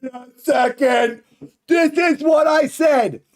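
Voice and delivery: loud, high-pitched vindication; excited shout. Real, not acted. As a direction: shout it, thrilled to be proven right. Sees old prediction coming true.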